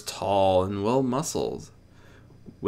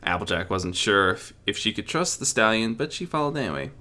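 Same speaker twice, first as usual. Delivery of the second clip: ordinary delivery, nothing marked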